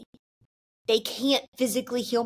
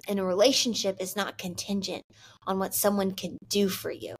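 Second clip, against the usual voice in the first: normal talking voice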